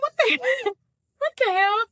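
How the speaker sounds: high, loud outraged disbelief; half-laughing, shrieking in disbelief; shocked. Retold or imitated. Outraged reaction retold to friend.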